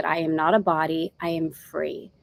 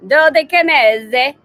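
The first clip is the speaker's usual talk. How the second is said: put-on loud, higher, drawn-out Italian accent; mock Italian accent; 5 semitones higher, 8 dB louder, slower than usual. Host jokes that she and Dave go Italian, mock Italian accent. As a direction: say it slowly like a hammed-up Italian. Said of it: "is either gonna be Italian".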